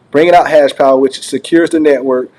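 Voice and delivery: ordinary delivery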